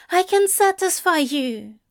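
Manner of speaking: high, intense blurt. A narrator's reading. Angel offering himself to demon, blurted intensely. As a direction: blurt it out, pitched right up. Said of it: "Aziraphale blurts".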